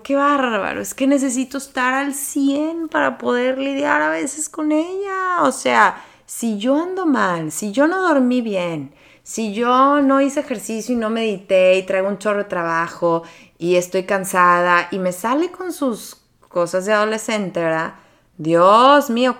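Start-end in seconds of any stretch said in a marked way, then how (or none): 0.0-5.4 higher, rising exasperated complaint. Retold or imitated. A mother venting to Rafa about her teenage daughter, exasperated. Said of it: "Yo le digo a Rafa". 18.4-19.4 loud, slow drawn-out sigh of an exclamation; really mean how much it matters; emphatic exclamation. Real, not acted. A mother stressing to listeners how vital self-care is, emphatic.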